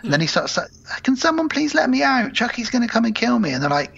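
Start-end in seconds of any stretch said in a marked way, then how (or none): 1.0-3.5 put-on high-pitched frightened child's plea; imitated tearful pleading. Locked-up boy begs to be let out, high mock-tearful plea. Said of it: "really crying properly to himself".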